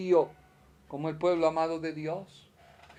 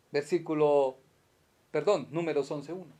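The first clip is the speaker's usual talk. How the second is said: ordinary delivery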